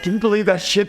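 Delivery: high, exasperated disbelief; incredulous. Retold or imitated. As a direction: scoff at the customer. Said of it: "I'm like".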